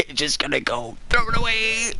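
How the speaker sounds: overacted betrayed sidekick; hammed-up reproach; mock hurt whine. [name]'s mock accusation.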